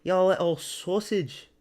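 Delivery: Paddy impression. Doing Paddy for chat, a high slow cheeky lad voice. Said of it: "that's the Paddy impression".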